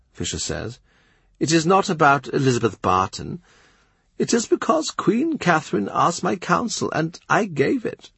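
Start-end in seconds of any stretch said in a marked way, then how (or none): 1.4-8.1 put-on old bishop, firm. Bishop Fisher telling Cromwell it is about the divorce, a higher firm voice. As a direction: set the record straight as a proud old bishop. Bishop names the real cause.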